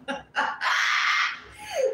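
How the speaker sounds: a sudden high shriek of a laugh. Real, not acted. Reader bursts out laughing at her own reading.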